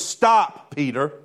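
firm, emphatic reprimand; harsh rebuke. Acted. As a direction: cut him off with a stern order. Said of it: "That sounds kinda harsh from Jesus".

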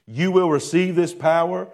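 usual speaking voice

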